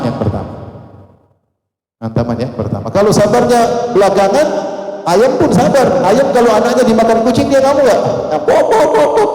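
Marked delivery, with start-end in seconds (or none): 2.9-8.0 mocking comparison. Mocking a hen's late patience. 8.0-9.3 high, comic pop pop of a hen; hen clucking noise. Acted. Imitating a hen pecking.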